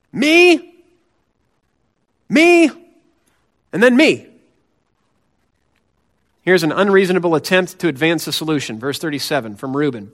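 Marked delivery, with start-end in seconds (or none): 0.1-4.3 much higher, louder whiny mimicry; mocking Jacob. Preacher mocks Jacob's speech to his sons in a high whine. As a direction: parody a self-absorbed whine.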